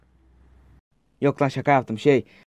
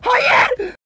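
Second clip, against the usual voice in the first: panicked shout. Real, not acted. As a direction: scream it in real panic. Panic yelling at the game. (panikle bağırarak)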